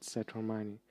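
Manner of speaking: ordinary delivery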